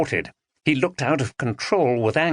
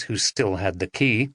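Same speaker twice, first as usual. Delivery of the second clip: ordinary delivery, nothing marked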